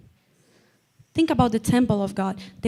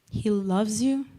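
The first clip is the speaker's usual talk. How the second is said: tender reassurance; 6 dB quieter than usual. Real, not acted. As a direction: let the preaching settle into gentle, quiet assurance. Closing assurance of God's love.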